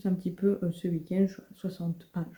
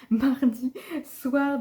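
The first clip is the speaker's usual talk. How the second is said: Mardi soir said slowly through a laugh; 5 semitones higher, much slower than usual. Real, not acted. Correcting her own slip to viewers, laughing. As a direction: laugh off the wrong day.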